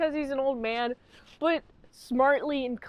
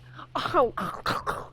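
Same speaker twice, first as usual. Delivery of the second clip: unvoiced throaty chomping noises; mock vampire attack noise. Reviewer acting out the vampire starting to nom on them, mock attack noise. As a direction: imitate a creature tearing in.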